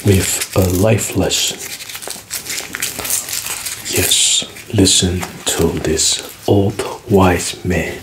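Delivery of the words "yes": ordinary delivery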